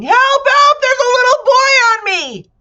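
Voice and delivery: very high, loud cry; shouting for help. A narrator's reading. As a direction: yell for help, squashed. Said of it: "lay there shouting".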